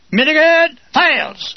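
high, loud, slow call; drawn-out official shout; voice of authority. A narrator's reading. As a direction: call out like someone in command. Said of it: "a voice of authority calls".

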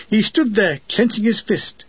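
plain reading voice

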